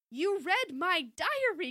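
loud, high, drawn-out accusation; accusing cry. A narrator's reading. Daughter confronts snooping mom, loud accusing cry. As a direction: cry it out slowly, outraged. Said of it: "Chrissy cried".

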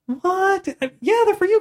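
high-pitched incredulous reaction; imitating confused kids, voice shot way up; baffled players. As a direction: squeak it out in mock disbelief. Players baffled by the king's answer.

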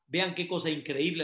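ordinary talk

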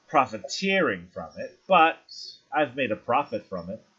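self-satisfied hostess. Acted. [name] explaining her skin business to visitors, coyly self-satisfied. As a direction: savour the words like a coy businesswoman.

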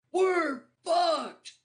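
very high, loud mock scream; imitated yell. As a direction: yell like a panicked man in the background. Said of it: "some dude in the background yell".

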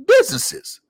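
Business leaps very high, answering your own question; squeaky high punchline Business; rhetorical answer. Real, not acted. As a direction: pop the word Business up high.

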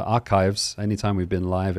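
ordinary delivery

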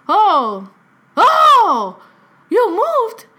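loud, very high imitation; grandfather's surprised realization. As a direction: play a grandfather finally catching on, astonished. Grandfather finally understands.

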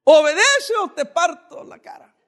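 loud, high furious yell at a son, last words slowed; an enraged parent screaming at his son; furious father. Retold or imitated. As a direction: roar a threat at a disobedient child. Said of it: "Algunos padres están diciendo".

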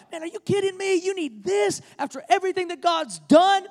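incredulous, pitched high; defensive disbelief. Retold or imitated. Preacher playing Moses defending God to the people, high and incredulous. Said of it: "getting defensive on God's behalf".

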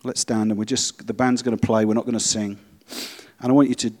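loud preaching call; preaching at full force. Real, not acted. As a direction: urge the whole room to stand and search their hearts. Calls congregation to stand.

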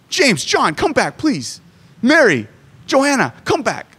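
half-hearted mock plea to come back; mock desperate calling. Mock Jesus calling disciples back: high pleading call. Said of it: "desperate for signatures".

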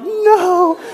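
high, loud frightened cry; terrified yelp. Retold or imitated. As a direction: squeal it in panic. Terrified under the bat.

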